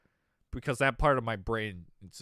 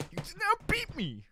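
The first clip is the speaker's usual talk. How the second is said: high, softer, mocking voice; mocking Michelle; 6 dB quieter than usual. Teasing Michelle's inflated ego in a high, soft, mocking voice. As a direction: parody her bragging.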